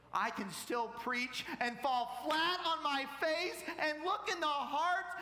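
slow, high, loud, stretched-out words; full-force preaching. Real, not acted. Preacher declaring he can still preach, full-force delivery. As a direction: preach it with mounting fervor.

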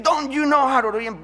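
loud, high, angry homeowner yelling; a Spanish-accented homeowner snapping at the preacher, mock angry. Homeowner yells at soul winner, loud and angry. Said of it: "And he said".

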